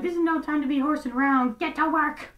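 a deliberately lazy take on a scolding boss; bad Kendall impression. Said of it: "I'm not trying to do a good impression of Kendall".

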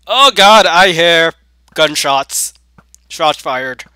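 high, loud outcry, then slowing; loud alarm. Real, not acted. Player hearing gunshots in game, alarmed shout. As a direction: shout a startled warning.

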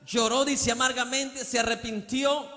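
high, rising preacher's fervour; pleading with the congregation, fervent preaching. Acted. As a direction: preach it with mounting passion. Impassioned preaching.

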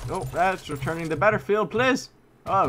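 rising loud yell on please; begging the game to let him live; excited shout. Real, not acted. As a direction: gamer yelling while nearly killed. Near death in a match.

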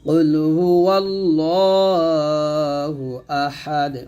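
slow model recitation, "ওয়াল্লাহু" stressed; recite after me. Shown as a demonstration. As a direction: lead the class in slow recitation. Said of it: "আবার বলেন আমার সাথে". (আমার সাথে বলুন, ধীরে তিলাওয়াত)

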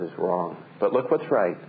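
ordinary delivery

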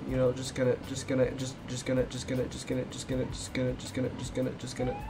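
very soft, repetitive mumble; quiet, level chant while concentrating; absent-minded muttering. Real, not acted. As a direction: mutter it under your breath on a loop. Mumbling while sneaking past in game.

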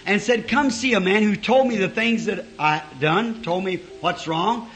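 building revival preaching. Acted. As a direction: preach the story out to the congregation. Preacher retells Bible story loudly.

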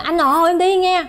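high-pitched call to her husband; gọi với. Retold or imitated. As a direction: re-enact calling out as she's wheeled away. Gọi chồng khi bị đẩy đi. (gọi với chồng, giọng cao)

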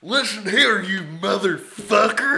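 put-on angry drunk Texan. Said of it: "Angry, drunk Texan".

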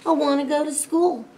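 put-on high voice of a nagging child; storybook kid pestering; insistent plea. A narrator's reading.

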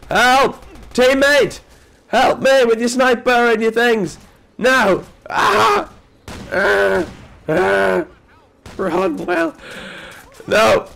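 high, loud cries for help; panicked shout. Real, not acted. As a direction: yell for your team in panic. Being killed, calling team.

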